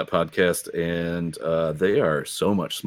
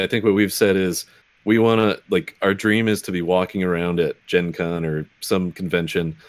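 normal talking voice

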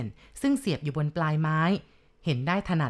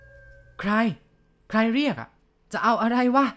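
loud, higher, scared demand; frightened shout; 5 semitones higher, 4 dB louder, much slower than usual. Acted. Village chief yelling at the hidden voice, scared loud shout. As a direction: bark it out to hide the fear. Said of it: "ร้องตะโกนถาม".

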